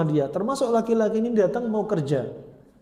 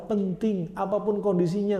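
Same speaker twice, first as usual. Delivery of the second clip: ordinary talk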